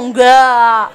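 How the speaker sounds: loud, drawn-out mock "no"; mock chorus answer. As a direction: belt out a long no, imitating the crowd. Mimics congregation's show-of-faith answer.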